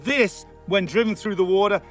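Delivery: ordinary talk, nothing marked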